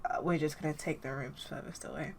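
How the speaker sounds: soft, low sheepish aside; awkward retreat. Acted. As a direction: back away quietly. Chen and Amy sidle away.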